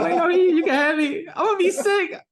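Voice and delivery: put-on high, loud little-girl protest; imitating daughter, high and laughing; daughter's voice. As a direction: squeak it out like a fussy six-year-old. Dad imitates daughter refusing food.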